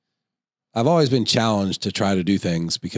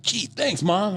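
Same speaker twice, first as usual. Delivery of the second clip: put-on very high, softer child voice; imitating a kid; 11 semitones higher, 3 dB quieter, much slower than usual. Kid thanking his mom, high imitated child voice. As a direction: pitch it way up like a thankful little kid. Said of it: "He's like".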